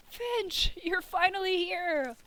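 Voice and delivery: high, bright call of a name; excited greeting. Acted. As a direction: call out happily to a friend arriving. Greeting arriving character.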